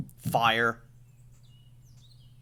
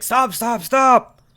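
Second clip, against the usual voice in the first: high, loud desperate shout; 12 semitones higher, 14 dB louder, slower than usual. Retold or imitated. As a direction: yell it to make him stop. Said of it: "shout out".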